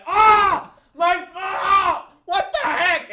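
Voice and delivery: high, loud hammed-up screaming; mock scream. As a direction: shriek as bugs attack. Victim screaming at ladybug attack.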